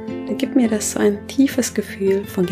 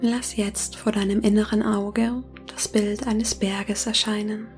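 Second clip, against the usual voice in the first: soft spa whisper; 8 dB quieter than usual. Acted. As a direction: whisper gently, guiding them into calm. Leitet eine Meditation. (sanftes Meditationsflüstern)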